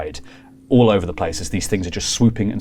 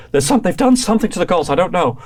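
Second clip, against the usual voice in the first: high, fast, lightly voiced worry; a touch of panic; 10 semitones higher, 4 dB louder, faster than usual. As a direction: NPC unsure about the gulls. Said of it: "so he goes".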